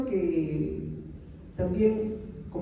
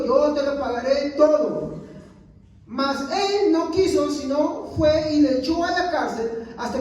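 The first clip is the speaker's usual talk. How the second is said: scripture reading; 4 semitones higher, 5 dB louder than usual. Acted. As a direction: read it out to the congregation like a preacher. Reads Mateo 18 aloud.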